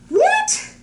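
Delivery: high, loud yelp of a question; shocked disbelief. Retold or imitated. Grown Thomas reads the principal's letter, shocked. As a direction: shoot way up in mock shock.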